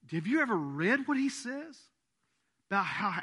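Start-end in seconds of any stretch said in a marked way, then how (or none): none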